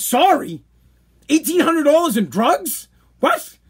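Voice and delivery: high, rising outraged questions; incredulous anger. Retold or imitated. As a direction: echo the word back in disbelief. Retold incredulous reply to mother.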